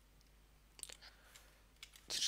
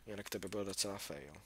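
ordinary talk

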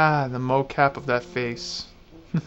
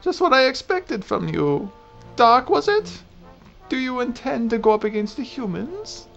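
put-on old lady voice; 7 semitones higher than usual. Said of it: "I get to do an old lady voice".

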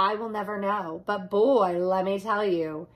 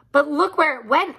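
louder, quick urgent call; excited; 7 semitones higher, 6 dB louder, faster than usual. Acted. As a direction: point it out in a hurry. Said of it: "I said".

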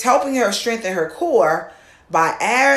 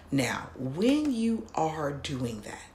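whisper; 4 semitones lower, 9 dB quieter, slower than usual. Shown as a demonstration. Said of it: "sudden whisper".

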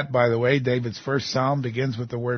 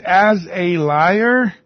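slow, loud, raised challenge; incredulous accusation; 4 semitones higher, 5 dB louder, much slower than usual. Acted. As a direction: stretch it out, accusing God to His face. Voicing Jeremiah's accusation to God.

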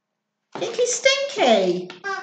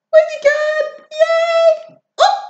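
high playful peekaboo; peekaboo sing-song; 12 semitones higher, 4 dB louder than usual. Acted. Parent playing peekaboo, high playful call. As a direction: play peekaboo with a toddler.

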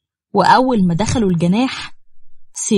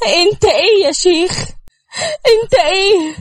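crying disbelief; 8 semitones higher than usual. Acted.

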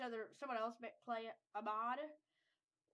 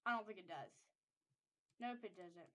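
usual speaking voice

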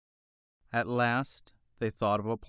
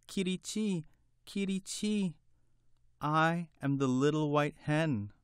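high, timid hen chirping; a tiny scared bird answering the king; frightened little voice; 6 semitones higher than usual. Acted. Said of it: "in her low, frightened little voice".

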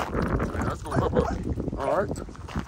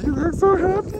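excited praise; 7 dB louder than usual. Real, not acted. Praising dog after the ramp.

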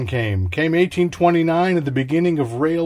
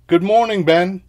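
cheery greeting; 5 semitones higher, 5 dB louder than usual. Acted.

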